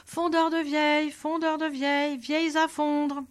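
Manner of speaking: put-on old man calls his trade across the town square; calling out. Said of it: "se mit à crier sur la place".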